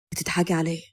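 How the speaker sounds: low, soft man's voice, puzzled; husband's mildly surprised murmur; puzzled question. A narrator's reading. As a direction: ask in low, quiet puzzlement. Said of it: "بص لها باستغراب وسألها".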